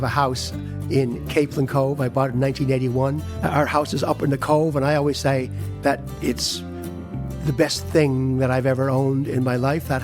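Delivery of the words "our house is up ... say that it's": ordinary delivery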